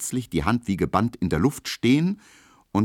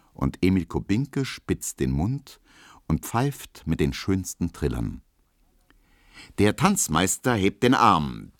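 usual speaking voice

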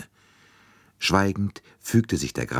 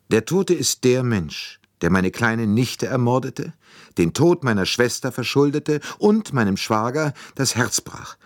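raised, trembling with passionate grief; 7 semitones higher than usual. A narrator's reading. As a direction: let the voice quake with love and loss. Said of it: "Ihre Stimme bebte vor leidenschaftlicher Erregung". (Stimme bebt vor leidenschaftlicher Erregung, lauter und höher)

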